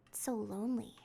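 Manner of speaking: hushed eerie whisper. Real, not acted. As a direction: whisper it like you might wake ghosts. Eerie abandoned house.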